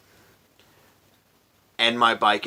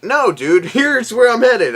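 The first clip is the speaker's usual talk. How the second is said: laughing exasperation; 10 semitones higher, 6 dB louder than usual. Retold or imitated. Showing cop the GPS, retold.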